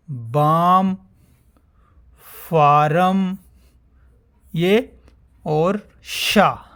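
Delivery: each word said loud and slow on its own; reading the word list. Shown as a demonstration. Teacher reading the exercise words aloud to the class, loud and slow. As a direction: call out every word clearly with a pause after it. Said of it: "इसमें first line में सभी words".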